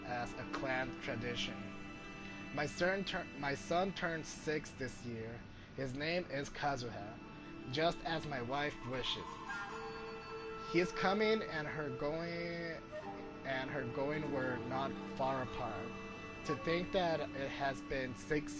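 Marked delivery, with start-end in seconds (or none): none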